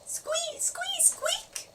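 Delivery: very high-pitched little mouse squeaks. A narrator's reading. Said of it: "She sounded exactly like a mouse".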